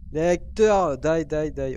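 loud, high-pitched yell; yelled taunt. Pretend. Player taunts the ghost, yelling. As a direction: shout it out across the haunted house.

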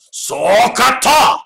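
high, loud drawn-out battle yell; samurai war cry. Pretend. Teacher demonstrates a samurai war cry to the class: loud drawn-out yell. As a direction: shout it like a samurai charging. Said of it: "say that really loud like a samurai warrior".

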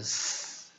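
"S" as a sustained hissed consonant. Shown as a demonstration. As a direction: hold a bare "S" sound with no vowel. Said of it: "这个C都会念成S".

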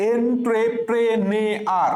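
এন্ট্রেপ্রেনেয়ার slowly broken into en-tre-pre-ne-ar; slow teaching chant of এন্ট্রেপ্রেনেয়ার; syllable by syllable. Shown as a demonstration. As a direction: stretch each syllable of এন্ট্রেপ্রেনেয়ার evenly. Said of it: "তাহলে আমি কি বলছি".

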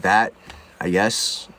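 deep mumbling store owner. Retold or imitated. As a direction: drop way down into a lazy deep bro voice. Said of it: "and he goes".

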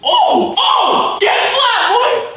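high, loud shout at a fly; excited yell. Real, not acted. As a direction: yell in triumph as you swat. Swatting a fly, yelling.

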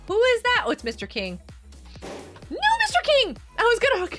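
ordinary talk

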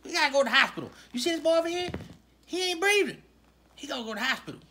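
usual speaking voice

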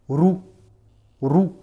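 roux with a rolled trilled R. Shown as a demonstration. Teacher demonstrating roux with a rolled R to the student. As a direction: roll the R in roux, tongue trilling. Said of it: "Donc il faut prononcer".

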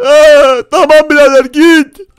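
high, loud wailed dismissal; tearful outburst. Acted. Crying player sending his friend away, tearful outburst. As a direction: wail it to send him off. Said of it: "Niye ağlıyorsun?".

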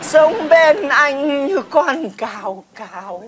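slow, drawn-out wistful recital; melodramatic reminiscence. Acted. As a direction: stretch the words like a tragic stage verse. Melodramatic reminiscence over grasshopper dish.

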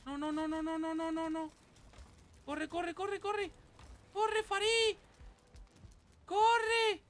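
high, frantic repeated cries; panicked urging. Real, not acted. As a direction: urge a character to flee for his life. Fleeing enemies in game.

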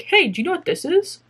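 questions lifted high, as if hailing passersby; pretend street interviewer. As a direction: accost strangers like a mock TV interviewer. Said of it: "go to people in public and be like".

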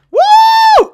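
high loud whoop; excited cheer. Real, not acted. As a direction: whoop with joy.

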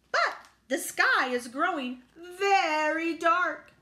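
slow, high, loud duck; quacking duck. A narrator's reading. Duck reporting the darkening sky, quacking. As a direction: draw out the ominous report. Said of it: "he quacked".

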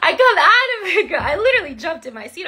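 laughing restart that collapses into giggles; real laughter, then fast. Real, not acted. Still laughing after scare.

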